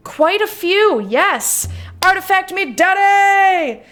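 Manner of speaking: loud, high-pitched gleeful cheer; over-the-top eager shout; excited shout. Acted. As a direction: whoop it out, drawing out the last word. Gleeful at finding game artifacts.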